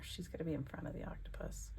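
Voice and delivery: breathy whisper to herself. Real, not acted. Crafter whispering a placement note to herself. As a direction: whisper it, like a secret note to self. Whispered aside while arranging collage.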